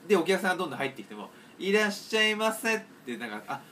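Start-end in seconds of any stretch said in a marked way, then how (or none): 1.6-2.8 slow, higher, drawn-out welcome; odd clerk's greeting. Retold or imitated. Clerk greeting each new customer, slow and higher. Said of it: "こういう喋り方なんだろう".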